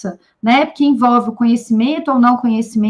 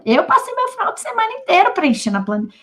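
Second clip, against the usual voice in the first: higher-pitched imitation of a grumbling teacher; mock indignant complaint; 7 semitones higher, 3 dB quieter, faster than usual. Teacher grumbling in the staff room about lost weekend, mock indignant. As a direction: act out a colleague's staff-room grievance.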